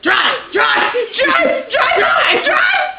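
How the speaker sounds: rapid-fire high screams, building; panicked shouting. Retold or imitated. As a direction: scream for the driver to flee. Retold panicked getaway order.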